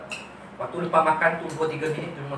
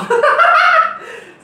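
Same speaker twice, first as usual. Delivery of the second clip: loud laugh; 10 dB louder than usual. Real, not acted. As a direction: burst of high laughter. Laugh heard after question. (tertawa lepas)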